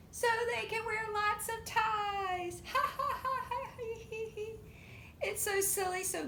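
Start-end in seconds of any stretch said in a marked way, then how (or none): 0.1-2.6 giggly punchline. Acted. Punchline read as joke. 2.7-5.2 high giggling laugh; high laughter. Acted. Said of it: "they all start to roar".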